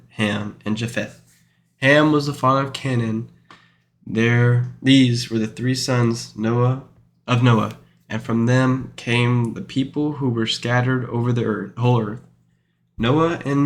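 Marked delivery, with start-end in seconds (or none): none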